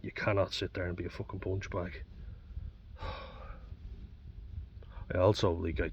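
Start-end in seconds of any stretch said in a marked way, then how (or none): 2.1-5.1 long, soft, unvoiced sigh; weary sigh. Real, not acted. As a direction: let out a long tired breath. Telling of family blame.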